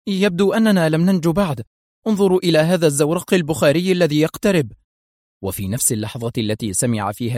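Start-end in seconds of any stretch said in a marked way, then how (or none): 0.1-4.7 raised, urgent cry of fear; narrated alarm. A narrator's reading. Ambassador's wife pointing at an approaching boat, alarmed. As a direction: voice the frightened wife at a higher pitch. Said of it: "قالت زوجة السفير بذعر".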